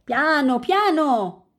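loud, high call to hold back; urgent interjection. A narrator's reading. Cart's finder stops his eager friends, calling out loudly.

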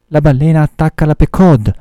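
narrated cry of alarm from the crew; terrified cry. A narrator's reading. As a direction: call out the danger like frightened sailors. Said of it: "gridarono i marinai atterriti".

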